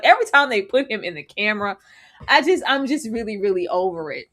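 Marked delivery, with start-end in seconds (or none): none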